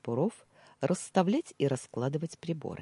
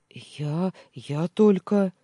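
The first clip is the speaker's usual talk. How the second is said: flustered; 4 semitones higher, 8 dB louder, much slower than usual. Acted. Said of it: "растерянным голосом сообщил Тимур".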